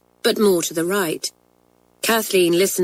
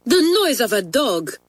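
higher, impatient French mistress; 5 semitones higher than usual. A narrator's reading. French teacher to a pupil denying the noise, impatient. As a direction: insist on it with impatience. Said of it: "said Mamselle impatiently".

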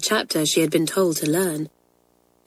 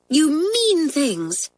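put-on sulky girls; 9 semitones higher, slower than usual. A narrator's reading. Girls complaining, blankets pulled off.

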